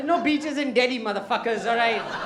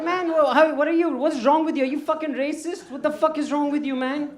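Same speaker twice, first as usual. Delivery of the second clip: higher and louder indignant yell; retold angry outburst; 6 semitones higher, 6 dB louder than usual. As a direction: rant at him in outrage. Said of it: "I was so angry with the first time".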